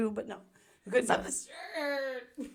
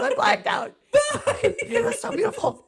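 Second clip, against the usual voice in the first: high, loud laughing imitation of friend; 13 semitones higher, 5 dB louder, slower than usual. Mimicking starstruck Skylar, laughing high. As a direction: quote her while cracking up. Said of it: "She goes".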